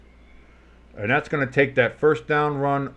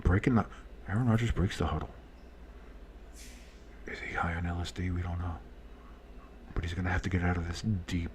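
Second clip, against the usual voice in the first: very soft, low bassy play-by-play; deep ASMR voice; 7 semitones lower, 12 dB quieter than usual. Pretend. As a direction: murmur it deep and hushed. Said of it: "Got that deep voice".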